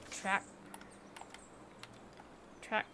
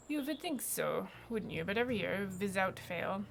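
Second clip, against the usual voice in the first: put-on grumbling farmer with a German accent. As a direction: complain about a rival in a German accent. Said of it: "I have no idea why it's a German accent".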